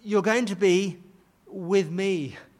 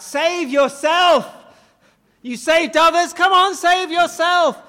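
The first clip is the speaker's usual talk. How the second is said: higher, louder jeering shout; mocking cry; 10 semitones higher, 11 dB louder than usual. Mockers jeering at Jesus on the cross, loud taunting cry. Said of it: "have cried out".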